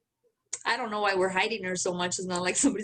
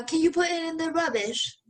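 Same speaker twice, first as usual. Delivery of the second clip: higher-pitched mock English woman's accent; imitated English accent; 7 semitones higher than usual. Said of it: "this English woman".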